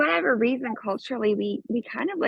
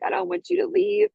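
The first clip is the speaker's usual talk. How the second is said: lightly voiced small child, pitched higher, slower; scared little girl; 10 semitones higher than usual. The five-year-old admits to her mother she is scared, a light childlike voice. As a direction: speak like a frightened child clinging to mom. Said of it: "she's like".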